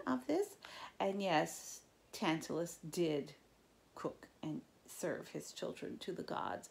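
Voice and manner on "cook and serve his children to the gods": slow dramatic whisper. Real, not acted. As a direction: say it as a spooky aside. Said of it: "reader stresses gruesome fact".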